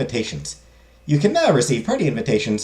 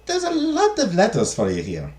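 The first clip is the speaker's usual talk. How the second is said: high-pitched maid in a put-on accent; hesitant servant voice, pitched up; confused, hesitant maid; 9 semitones higher than usual. Maid brings madame an armload of letters, confused and hesitant. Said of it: "confused and hesitant".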